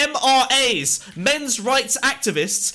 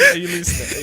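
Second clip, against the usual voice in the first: laughing; 9 semitones higher, 3 dB louder than usual. Real, not acted. Laughing at co-host.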